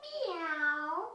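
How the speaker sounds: be a silly kitty; playful high meow for the kids. Shown as a demonstration. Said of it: "Be silly".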